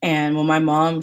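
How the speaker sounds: usual speaking voice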